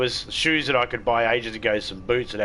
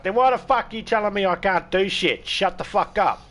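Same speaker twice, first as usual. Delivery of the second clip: 1 angry retort; 10 semitones higher, 4 dB louder than usual. Retold or imitated. Mock retort to religious people.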